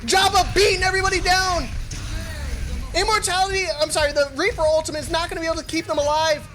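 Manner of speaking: screaming high as the clutch unfolds; excited shouting call. Real, not acted. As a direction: lose it over the one v one. Final one v one climax.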